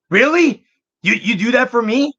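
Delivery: high, loud squeal of disbelief; surprised delight. Retold or imitated. Friend's delighted surprise retold.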